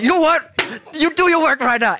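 put-on sudden high, loud yell at a stubborn kid; snapping yell. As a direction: patience gone, bark the order. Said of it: "I, I lost it".

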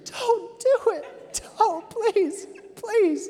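very high, slow, squeaky mock begging; pleading body. The calf begging the preacher to stop, squeaky high plea. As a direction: beg in a tiny high voice. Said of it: "My body's, like, screaming".

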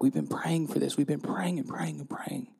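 whispered, worn-out repetition; hushed, exhausted repeated plea; weary whisper. Real, not acted. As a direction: let it fade to a tired whisper. Grieving unanswered prayer.

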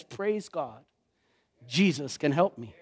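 soft, low, settled relief; relieved answer. Retold or imitated. Preacher voicing Paul's relief after the cry, soft and low. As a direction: let the answer land quietly.

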